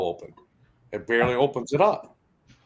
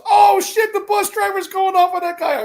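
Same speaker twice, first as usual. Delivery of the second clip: very high, loud excited kid imitation; shrieky mock schoolkid commentary; kids shouting from the back; 9 dB louder than usual. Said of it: "I hear from the back of the bus".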